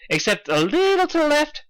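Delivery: high, eager pony voice; bubbly Pinkie. Acted. Pinkie directing Dash on the cloud, high and eager. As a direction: chirp it like a giddy party pony.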